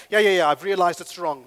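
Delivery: loud, brushing-off yeah yeah yeah; glib answer. Shown as a demonstration. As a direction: dismiss it quickly and loudly. Glib answer, loudly acted.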